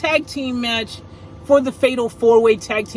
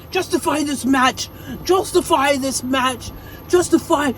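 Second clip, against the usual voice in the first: Arnold Schwarzenegger; 4 semitones higher than usual. Retold or imitated. Angry champion demanding answers from Pierce, in a mock Austrian accent. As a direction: bark it like an action-movie Austrian. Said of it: "Gunther was like".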